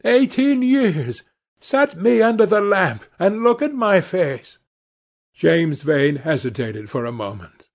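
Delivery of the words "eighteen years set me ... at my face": high-pitched, exultant challenge; triumphant. A narrator's reading. Accused man to the gunman, demanding inspection, triumphant. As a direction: challenge him, voice lifted in triumph. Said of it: "a touch of triumph in his voice".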